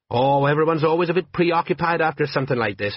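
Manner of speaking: cheerful report to his commander; eager sergeant. Acted. Sergeant cheerfully briefs Vimes after the fire, eager voice.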